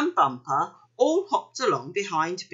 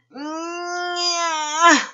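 long, high straining whine; drawn-out squeal of effort; straining effort noise; 11 semitones higher, 4 dB louder than usual. A narrator's reading. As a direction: build the strain to a loud release. Keith strains to hop again.

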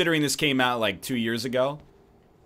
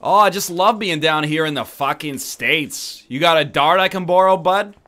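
mock Canadian accent; 4 semitones higher, 7 dB louder than usual. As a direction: play a cheerful Canadian in a hammed-up way. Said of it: "My people".

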